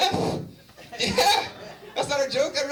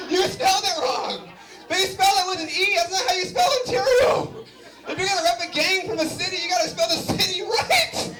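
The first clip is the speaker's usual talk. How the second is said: mock scolding; 9 semitones higher than usual. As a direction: lecture the tagger, building to a shout. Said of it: "And I was like".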